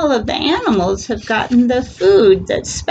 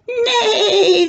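much higher whinny; horse neigh; 14 semitones higher than usual. Acted. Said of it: "says the horse".

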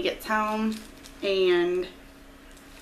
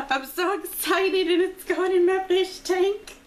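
high, loud, near-tearful excitement; excited squeal; 8 semitones higher, 3 dB louder than usual. Real, not acted. Vlogger gushing over a friend's fish tank gift, squealing high. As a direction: squeal it out, overjoyed.

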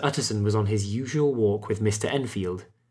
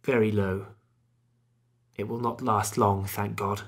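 subdued, gloomy resignation; dreary. A narrator's reading. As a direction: let each short phrase sink drearily. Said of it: "replied the doctor drearily".

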